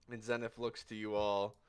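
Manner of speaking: normal talking voice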